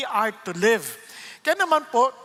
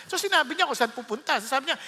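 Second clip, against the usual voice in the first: normal talking voice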